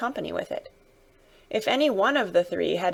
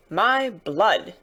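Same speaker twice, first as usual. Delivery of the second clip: vexed exclamation. Acted. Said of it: "ejaculated the vexed coachman".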